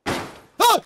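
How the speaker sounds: high sudden yelp of fright; startled scream. Real, not acted. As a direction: jump and yell in shock. Sudden scare in game.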